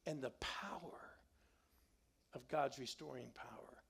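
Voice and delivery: hushed unvoiced whisper; breathy whispered promise of God's power. Real, not acted. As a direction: drop to a reverent whisper. Sermon climax drops to hush.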